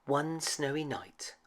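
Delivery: plain reading voice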